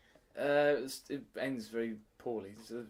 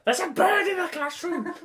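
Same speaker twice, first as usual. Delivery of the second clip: put-on much higher, louder childish cry; excited kids; 8 dB louder than usual. Teacher imitating pupils shouting about a bird, high and loud. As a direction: squeal it like a thrilled child. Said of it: "they were all running around going".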